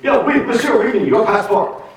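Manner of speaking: put-on high French-accented demand; high officious French-accented inspector. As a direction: Clouseau asking for the passport.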